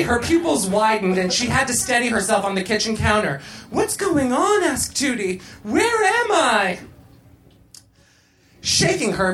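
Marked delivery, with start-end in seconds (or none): 3.7-4.6 high, confused question; bewildered. A narrator's reading. Tootie starts tripping in the kitchen, bewildered. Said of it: "bewildered and panicked Tootie". 5.6-6.8 high, drawn-out wail; bewildered panic. A narrator's reading. Tootie loses track of where she is, bewildered panic. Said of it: "bewildered and panicked Tootie".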